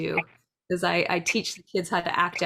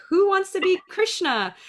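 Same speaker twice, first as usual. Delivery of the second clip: mother calling out; 11 semitones higher, 4 dB louder than usual. Retold or imitated. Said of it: "So she'd be like".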